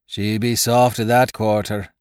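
lightly voiced tipsy pirate; tipsy gunner. A narrator's reading. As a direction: tease the captain like a drunken shipmate. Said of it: "spoke up Gunner Basil with a hiccup".

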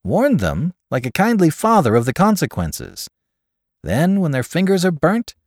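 high, loud, emphatic counsel; confident adviser. A narrator's reading. Caller counsels his friend on handling them, confident and emphatic.

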